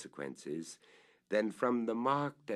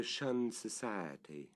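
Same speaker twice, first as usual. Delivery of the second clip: usual speaking voice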